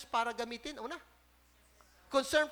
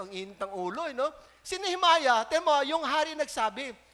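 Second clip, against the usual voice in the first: usual speaking voice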